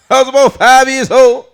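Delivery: loud, high-pitched comedian impression; Bernie Mac. Comic rereads the quote as an adult, loud high impression. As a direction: belt it out as a brash adult comic. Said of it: "reread it as Bernie Mac's voice".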